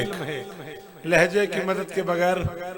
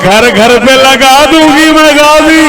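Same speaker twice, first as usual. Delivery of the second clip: full-force recitation; 3 semitones higher, 16 dB louder than usual. Acted.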